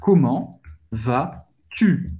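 "Comment vas-tu?" lento, mais grave, subindo no fim; slow low. Shown as a demonstration. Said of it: "Usando o tu". ("Comment vas-tu?" lento e grave)

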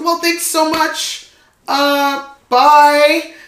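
high, slow, loud mock-polite farewell; awkward goodbye. Guest leaving as the couple fights, awkwardly polite. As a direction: re-enact an excruciatingly polite exit.

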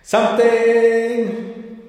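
slow, echoing drawn-out word; reverb voice. Pretend. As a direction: stretch it out with echo. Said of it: "I'll give you a reverb".

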